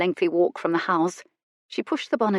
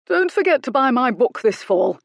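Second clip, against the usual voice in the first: higher, louder parting reminder; less aggrieved parting; 6 semitones higher, 5 dB louder than usual. A narrator's reading. Goodkind calling back to Miss Brightly, mildly aggrieved. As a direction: call back over his shoulder, still a bit huffy. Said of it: "on a slightly less aggrieved note".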